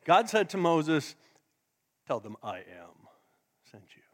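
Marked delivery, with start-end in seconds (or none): 2.1-4.0 much lower, softer, solemn divine voice; deep voice of God. Retold or imitated. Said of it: "I'm sure God had a deep voice".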